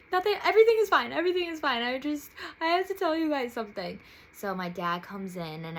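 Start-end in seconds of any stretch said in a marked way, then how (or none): 0.1-4.0 high, loud, rushed tearful denial; tearful insisting. Retold or imitated. Telling her family she is fine while crying, high and rushed. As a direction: blurt it out through tears, fast.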